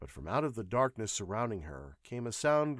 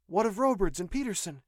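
high, louder female question; demanding; 10 semitones higher, 6 dB louder than usual. A narrator's reading. Nurse asks pilot about the others, demanding. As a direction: press him sharply for news. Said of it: "she demanded".

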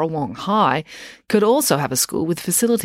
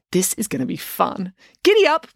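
gleeful rider; 3 semitones higher than usual. Acted. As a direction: whoop it like a jockey. Loretta rides Joe like horse.

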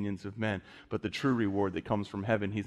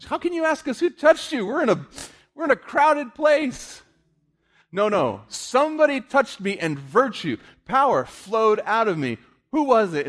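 1 preaching at full force; 13 semitones higher, 8 dB louder than usual. Acted.

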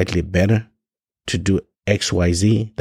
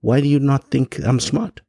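ordinary delivery

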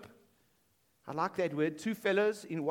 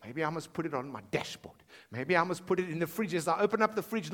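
ordinary talk

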